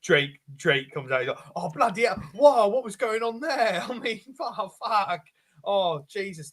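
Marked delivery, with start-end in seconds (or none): 1.6-6.5 high, posh British exclamations; posh character voice. Acted. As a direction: put on a posh accent. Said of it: "Oh, I was acting so weird".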